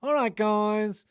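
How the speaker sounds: drawn-out, slow American "All right, guys", still high; slow American accent. Retold or imitated. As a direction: stretch the American influencer greeting out slowly. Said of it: "the same pronunciation".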